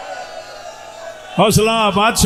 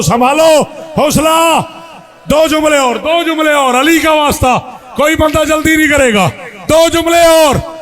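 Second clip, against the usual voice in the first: preaching at full force; 4 semitones higher than usual. Real, not acted. Calming weeping crowd loudly.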